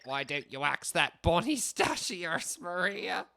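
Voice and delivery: a loose attempt at an Irish lilt; a mock Irish warrior, half-hearted; improvised Irish-ish voice. [name] teasing Mario in a mock Irish voice. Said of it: "whatever fuck-ass voice I'm doing".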